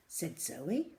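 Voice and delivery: ordinary delivery, nothing marked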